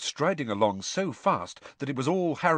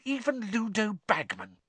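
high, seething accusation; tightly held indignation; through gritted teeth; 8 semitones higher than usual. A narrator's reading. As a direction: accuse with contained anger. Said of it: "through gritted teeth".

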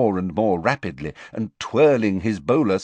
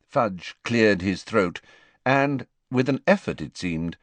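usual speaking voice

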